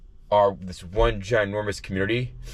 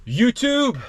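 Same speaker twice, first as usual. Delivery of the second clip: high, bright sign-off call; excited call-out; 5 dB louder than usual. Real, not acted. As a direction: sing out the channel name. Calling out to viewers.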